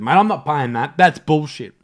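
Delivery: loud, indignant refusal; retold loud refusal to the seller; loud haggling. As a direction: push back hard on the price.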